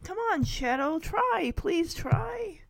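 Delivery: high, imploring coax; pleading. Acted. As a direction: beg gently but urgently. Sassy begging Shadow to try.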